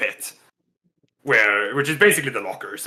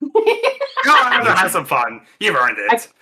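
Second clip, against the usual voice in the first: higher, louder, laughing kindly; higher laughing champion voice; the champ, generous; 8 semitones higher, 5 dB louder than usual. Acted. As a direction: send him off with a grin. Champ gives away his ticket.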